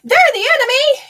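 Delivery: high, loud self-righteous whine; mock the proud defensive people. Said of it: "they're gonna say".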